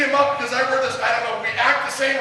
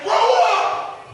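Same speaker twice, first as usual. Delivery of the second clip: retold shout; 7 semitones higher than usual. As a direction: bark it the way the mentor yelled it at him. Retold mentor yelling at crying coach.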